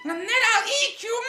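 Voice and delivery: very high threatening witch voice; wicked witch. A narrator's reading. Witch fattening caged Hansel, wicked relish. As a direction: build a high, gleeful threat. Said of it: "She said".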